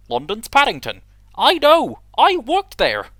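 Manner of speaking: high, loud squeaky engine; Duck voice. Acted. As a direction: squeak it out indignantly. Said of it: "I can't do a Duck voice very well".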